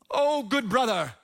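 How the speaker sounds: lightly put-on archaic English; a mild mock Shakespearean greeting; old-fashioned formal voice. Preacher voicing Jacob greeting his brother, lightly archaic. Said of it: "I don't know why I'm talking like that".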